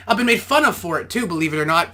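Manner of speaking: ordinary talk, nothing marked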